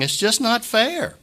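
high, slow, pained quote of grieving people; mourners' protest. Retold or imitated. Pastor quoting mourners at a funeral, high and pained. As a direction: voice the hurt of those at the funeral. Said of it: "people coming up and saying".

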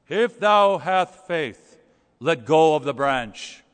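very slow, loud, solemn booming; booming voice of God. Retold or imitated. God speaking from heaven to the hanging man, booming slowly. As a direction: boom down from heaven, unhurried and grand. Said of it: "this voice booms from heaven".

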